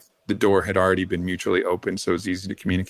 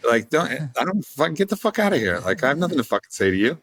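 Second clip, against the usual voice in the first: high, snappy mock telling-off; mock hostile brush-off; 3 semitones higher than usual. An unwelcoming entity telling him to leave, mock hostile. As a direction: shoo them away like an annoyed stranger. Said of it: "the encounter was always like".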